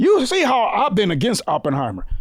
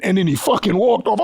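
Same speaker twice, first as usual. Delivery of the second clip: ordinary talk, nothing marked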